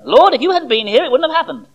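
an injured, indignant reproach; slightly injured, indignant. Retold or imitated. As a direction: sound hurt and put out with him. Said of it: "slightly injured, slightly indignant tone".

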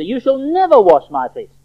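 high, loud protest; forceful, indignant objection read out; emphatic refusal. Acted. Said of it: "very emphatic".

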